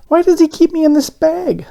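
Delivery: very high, puzzled little voice; high donut voice wondering. A narrator's reading. Arnie in the paper bag, high and puzzled. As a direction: wonder aloud in a tiny voice. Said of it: "Arnie wondered".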